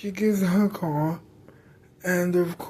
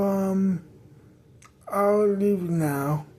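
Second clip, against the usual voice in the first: usual speaking voice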